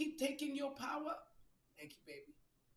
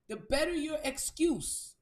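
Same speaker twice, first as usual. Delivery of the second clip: loud slow emphasis; 3 semitones higher, 8 dB louder than usual. Real, not acted. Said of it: "repeated for emphasis".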